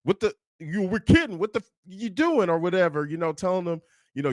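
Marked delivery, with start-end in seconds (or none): 0.0-2.5 a touch of angry, higher; half-hearted angry scolding; mild mock anger. Jimmy snapping at Tommy, mild imitated anger. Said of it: "had the nerve to get mad at Tommy".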